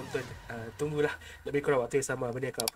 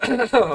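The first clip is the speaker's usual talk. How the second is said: cough; 9 semitones higher, 11 dB louder than usual. Real, not acted. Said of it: "[name] kurang sihat je".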